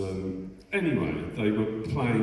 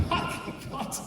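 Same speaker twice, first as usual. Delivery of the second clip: laughing disbelief; 5 dB quieter than usual. Retold or imitated. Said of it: "shock at arrival".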